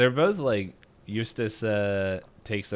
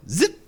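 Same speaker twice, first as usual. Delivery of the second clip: high, loud zip effect; zip sound; 13 semitones higher, 8 dB louder than usual. Acted. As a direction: snap out a zipper noise. Imitating a zipper sound.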